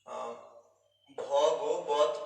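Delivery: ordinary delivery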